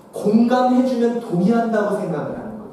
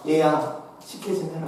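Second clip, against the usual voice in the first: lower, softer offhand command; mother-in-law's order; 6 semitones lower, 6 dB quieter than usual. Retold or imitated. Mother-in-law ordering her daughter-in-law to cook, low and offhand.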